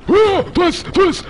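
high loud frightened cry; startled panic. Real, not acted. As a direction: caught off guard, panicking. Caught by android.

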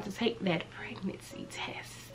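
nervous hushed whisper; whispering in suspense. Real, not acted. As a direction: breathe the words out, scared. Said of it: "I'm shaking".

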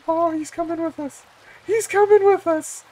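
very high, giddy squeal; overjoyed high-pitched voice; excited squeal. Real, not acted. Thrilled creature joins the boat.

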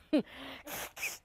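a short real laugh, caught off guard. Real, not acted. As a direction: laugh it off, half embarrassed. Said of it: "Told you I might make you laugh".

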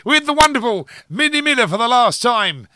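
loud, high showman's announcement; playful, over-the-top radio fanfare; hyped-up introduction. Pretend. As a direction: belt out a grand send-off. Host hypes up interview introduction.

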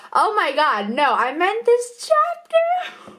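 high, laughing no-no-no; laughing flustered. Real, not acted. Author laughing at her own blunder on camera, laughing.

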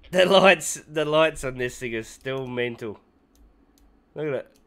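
excited. Real, not acted. Excited about ship lights.

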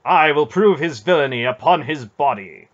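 loud, grim resolve; grim vow. A narrator's reading. Knight vowing vengeance on the baron, grim and loud. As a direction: swear it like an oath of vengeance. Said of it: "Manderallen said grimly".

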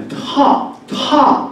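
「タ」のTに息をどっと乗せる; 高めに、息を爆発させて「タ」; 強い気息のT. Shown as a demonstration. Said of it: "息すげえ出ちゃってるような". (息たっぷりの英語式「タ」)

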